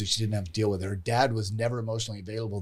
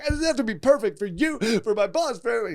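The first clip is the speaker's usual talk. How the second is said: crying; 12 semitones higher, faster than usual. Retold or imitated. Client crying, retold.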